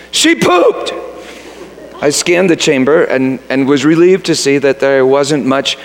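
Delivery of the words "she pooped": put-on high, gleeful child-like cry; kids exclaiming. Preschoolers tattling to a dad, high gleeful exclaim. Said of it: "and exclaimed".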